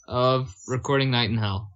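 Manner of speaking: ordinary talk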